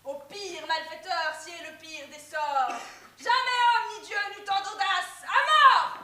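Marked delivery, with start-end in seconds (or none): 3.2-6.0 furious tragic shout. Acted. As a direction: roar the death sentence. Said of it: "y a qu'elle pour crier comme ça".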